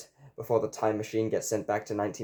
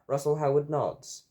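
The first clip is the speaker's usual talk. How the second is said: ordinary delivery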